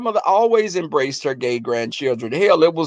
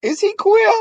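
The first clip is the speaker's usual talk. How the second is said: high-pitched imitation of a nosy grandmother; 14 semitones higher than usual. Grandmother asking about someone's sexuality, high imitated voice. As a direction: mimic grandma's question, voice way up. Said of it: "she would say queer".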